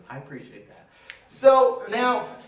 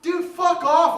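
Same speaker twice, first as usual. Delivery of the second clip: loud mock-offended yell at the heckler; mock outrage; 10 semitones higher, 7 dB louder, slower than usual. Real, not acted. Comic yelling at a heckler in mock offense. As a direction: shout it back, playfully offended.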